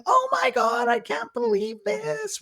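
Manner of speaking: very high, slow mock squeal; giggling girls. Girls pointing at the stripper, mock giggly squeal. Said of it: "they're screaming and they're laughing".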